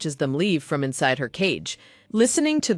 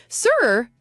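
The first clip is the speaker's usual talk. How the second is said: calling out; 5 semitones higher than usual. A narrator's reading. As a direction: call out to get attention. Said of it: "he calls out".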